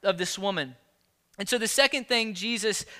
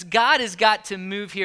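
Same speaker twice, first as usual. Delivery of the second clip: ordinary talk, nothing marked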